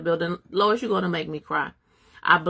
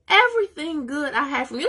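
emphatic praise; 9 semitones higher, 4 dB louder than usual. Real, not acted. Emphatic re-quote of verse.

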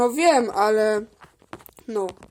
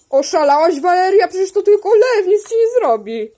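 loud, raised-pitch mock telling-off; exasperated scolding; 4 semitones higher, 5 dB louder than usual. Telling frightened Waleria the lion is harmless, loud and exasperated. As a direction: scold loudly, as if she's being ridiculous.

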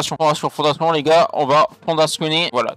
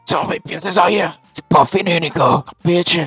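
parrain éraillé qui menace, voix forcée; voix de cancéreux; 4 semitones higher, 4 dB louder than usual. Acted. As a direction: lance la menace d'une voix de mafieux malade. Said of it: "Je ne vais plus avoir de voix". (voix de cancéreux forcée, menaçante)

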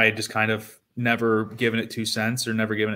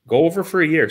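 higher and louder, pushing the advice hard; emphatic urging; 7 semitones higher, 7 dB louder, faster than usual. Retold or imitated. Agent pressing the player, emphatic, louder. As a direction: sell it like an eager agent.

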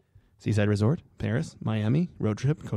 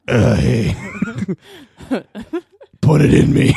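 raspy seductive come-on; hammed-up seductive voice; sexy voice; 7 dB louder than usual. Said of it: "This is my sexy voice".

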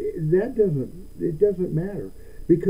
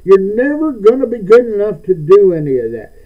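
mock Satan taunt; 4 semitones higher, 9 dB louder than usual. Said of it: "you know".

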